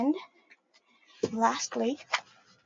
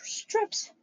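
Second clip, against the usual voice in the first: whispered word; 7 dB quieter than usual. Acted.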